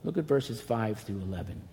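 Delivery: ordinary talk, nothing marked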